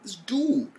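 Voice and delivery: high, strained exasperation; stunned disbelief. Retold or imitated. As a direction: groan it in disbelief. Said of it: "And I'm like".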